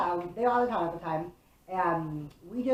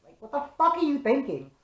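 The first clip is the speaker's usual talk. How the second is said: higher and louder, exasperated scold; retold scolding; 7 semitones higher, 5 dB louder than usual. To the boys near the expressway, a higher, louder exasperated scold. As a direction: scold them like a fed-up big sister.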